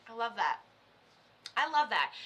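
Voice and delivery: ordinary talk, nothing marked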